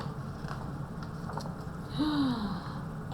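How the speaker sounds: long breathy suspense gasp; whispered gasp. Acted.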